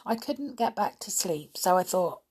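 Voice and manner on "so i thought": ordinary delivery, nothing marked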